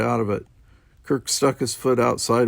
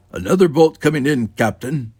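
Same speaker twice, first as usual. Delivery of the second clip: loud, higher-pitched droned report; droning; 4 semitones higher, 7 dB louder than usual. A narrator's reading. Sulu playing Spock warns captain, droning. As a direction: drone the warning out like an official. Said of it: "Sulu droned".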